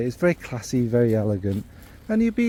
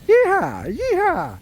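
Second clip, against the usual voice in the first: high, whooping Texas yeehaw call; self-consciously hammed-up Texan cowboy whoop; slower than usual. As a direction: holler it like a rodeo cowboy, pitch flying up. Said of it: "I can't do accents and I shouldn't".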